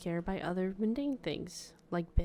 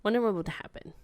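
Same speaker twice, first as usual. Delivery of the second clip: ordinary talk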